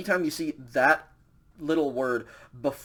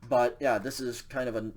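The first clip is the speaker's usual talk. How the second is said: ordinary delivery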